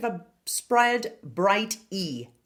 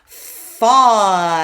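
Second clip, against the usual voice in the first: "Falling" said loud with a clear L; loud demo word; 12 dB louder than usual. Shown as a demonstration. Coach models falling loudly for the class. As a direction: project "Falling" and land the L. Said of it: "Watch out again for that L again".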